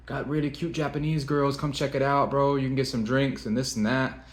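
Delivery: hint of a hustler's sales pitch; pushy tout. Retelling a pushy bar tout's pitch.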